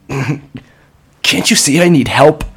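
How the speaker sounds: high, slow, loud pleading; desperate plea. Acted. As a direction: beg through a broken laugh. Desperate plea after laugh.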